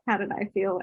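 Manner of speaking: ordinary talk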